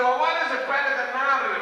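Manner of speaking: fast, high-pitched challenge; pressing student. Retold or imitated. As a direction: press the tutor with a quick question. Voices student's rapid challenge.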